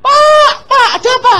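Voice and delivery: very high, loud mock wail; hammed-up panic at very high pitch; panicked maid. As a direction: cry out in shrieking alarm. Maid finds house cleared, alarmed.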